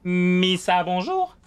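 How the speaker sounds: high, loud squeaky alien voice; Jar Jar Binks voice. Acted. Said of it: "J'avais un skin Jar Jar Binks".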